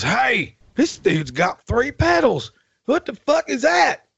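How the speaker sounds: high and slow, mocking the guy who never saw three pedals; mocking bandleader voice. Bandleader mocked in retold story.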